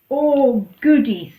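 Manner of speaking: loud, delighted oh goody; thrilled. Acted. Goat thrilled Donkey offers a story, loud glee. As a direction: burst out with glee.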